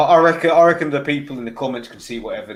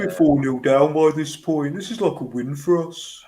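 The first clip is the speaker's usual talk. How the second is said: put-on Brummie accent; 2 semitones higher than usual. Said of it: "my Brummie accent".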